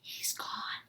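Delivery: unvoiced soft whisper; hiding, whisper the all-clear. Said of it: "he heard Josh whisper".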